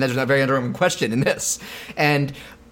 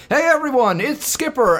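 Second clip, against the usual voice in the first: excited announcement; 10 semitones higher than usual. Retold or imitated. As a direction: announce the news gleefully.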